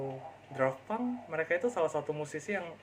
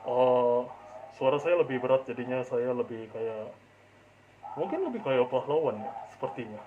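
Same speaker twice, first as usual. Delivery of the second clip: loud, lower heroic voice; deep hero voice; 3 semitones lower, 5 dB louder than usual. Acted. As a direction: boom it out like a movie hero. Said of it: "mungkin jadi sosok hero kayak".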